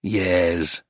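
low, lazy drawl. A narrator's reading. Painter finally answers the dealer, drawling. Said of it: "he drawled".

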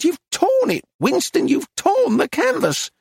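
very high, accusing outcry; dismayed cry. A narrator's reading. Dealer accuses painter of tearing the canvas, crying out. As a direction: cry it out at a high pitch, aghast. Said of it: "she cried".

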